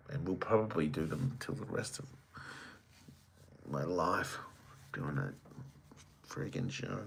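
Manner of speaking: weary unvoiced whisper; a tired whispered aside, trailing off. Real, not acted. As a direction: mutter it under your breath, drained. Weary aside about endless shows.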